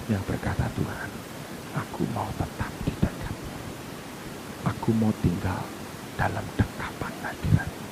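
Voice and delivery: low, whispery, reverent voice; soft intimate prayer, nearly whispered; hushed devotional preaching. Real, not acted.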